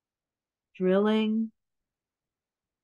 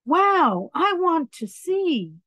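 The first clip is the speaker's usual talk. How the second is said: eager wow; 7 semitones higher than usual. Acted. Character eager to see.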